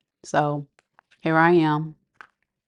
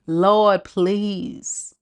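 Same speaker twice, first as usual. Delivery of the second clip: begging prayer; 3 semitones higher, much slower than usual. Retold or imitated. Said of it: "like begging the universe".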